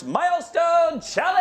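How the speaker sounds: big, loud segment reveal; announcer shout. Real, not acted. Host unveiling the livestream's next corner, announcer shout. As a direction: shout the title like a game-show host.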